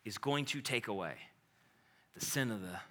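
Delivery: soft, low reverent reading; hushed scripture. Acted. Preacher reading scripture softly.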